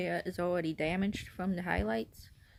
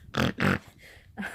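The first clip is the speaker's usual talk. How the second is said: nervous laugh; 4 dB louder than usual. Real, not acted.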